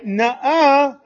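loud, quick setup into drawn-out nuh-uh; hammed-up congregational dissent; playful objection. Shown as a demonstration. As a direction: punch it out like a cheeky heckler. Playful contrast to saying Amen.

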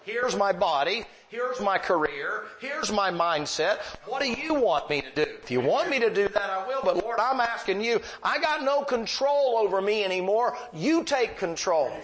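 preached surrender to the Lord; pleading prayer from the pulpit. Acted.

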